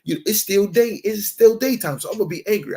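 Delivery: mock the son insisting on his anger; son's excuse. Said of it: "the son, he said".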